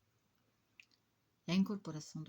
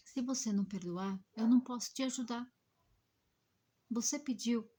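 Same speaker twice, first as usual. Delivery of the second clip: louder, higher, urgent warning; firm insistence; 5 semitones higher, 8 dB louder than usual. Retold or imitated. Medium pressing the spirit to forgive, loud and urgent. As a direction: push him hard to forgive.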